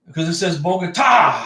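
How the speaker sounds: ordinary talk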